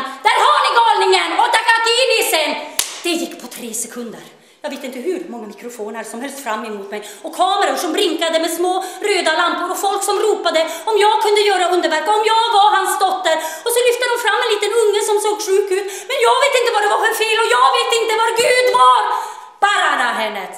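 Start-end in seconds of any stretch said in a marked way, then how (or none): 0.0-2.5 loud, high, laughing yell; gleeful loud yell with a laugh; neighbour shouting. Retold or imitated. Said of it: "så skrek han". 7.4-19.0 fast, loud, higher rush; breathless rushing, laughing at the end; frantic. A narrator's reading. Frenzy of the press crowd. 19.6-20.5 crowd demand. Retold or imitated. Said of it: "sa de".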